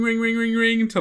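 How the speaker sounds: normal talking voice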